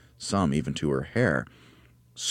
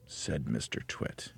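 ordinary delivery

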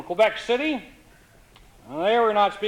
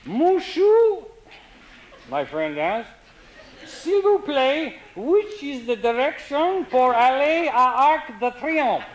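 slow halting French; 7 semitones higher, slower than usual. Retold or imitated. Tourist tries French on Frenchman.

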